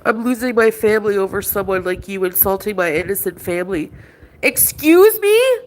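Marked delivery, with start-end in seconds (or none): 4.4-5.6 much higher, slowed "excuse me"; shocked, offended comeback; indignant, incredulous question; outraged disbelief. Real, not acted. Outraged reply in an argument.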